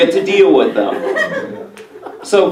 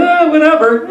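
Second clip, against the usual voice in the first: shrugging whatever; 10 semitones higher than usual. Retold or imitated. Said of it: "you kinda just go".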